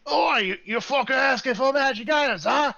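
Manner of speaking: high, loud, cranky dwarf shouting from the back; grumpy dwarf yelling; gruff dwarf voice. Acted. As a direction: bark it out like an annoyed old smith. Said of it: "is a dwarf".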